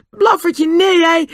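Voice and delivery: very high anguished wail; Nobby's choked voice. A narrator's reading. Boy holding his poisoned dog, choked high cry. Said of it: "zei Nobby met verstikte stem".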